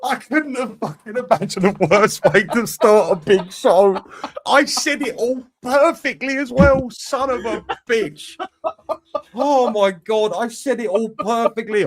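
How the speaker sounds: high, loud and slow frustrated rant; real exasperation after ruining the intro; exasperated rant. Real, not acted. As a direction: vent the botched intro, drawn out. Host frustrated after botched intro.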